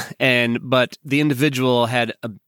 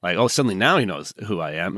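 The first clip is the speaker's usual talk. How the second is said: ordinary talk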